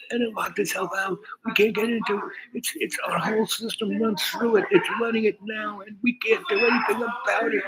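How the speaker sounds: high, anxious imitation of the client; mock freaked out. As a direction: gabble it like a man in a mess. Client quoted confessing lockout.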